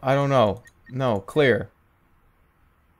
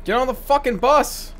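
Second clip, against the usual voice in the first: higher, exasperated snap; fed-up demand; 9 semitones higher than usual. Real, not acted. Driver fed up with passengers who won't board, exasperated. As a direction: lose patience with the passengers.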